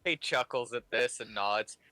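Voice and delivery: ordinary talk, nothing marked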